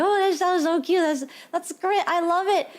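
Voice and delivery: put-on high, gushing baby-talk coo; imitated adoring gush. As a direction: coo as if squeezing their cheeks. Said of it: "squeeze their cheeks and it's like".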